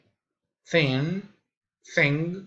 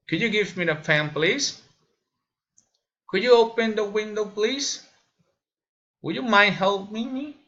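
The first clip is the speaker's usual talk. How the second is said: high-pitched requests, climbing on "please" and "me"; rising intonation; 6 semitones higher than usual. Shown as a demonstration. Learner demonstrating requests, high rising intonation. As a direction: lift "please" and "me" at the end. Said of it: "raising intonation".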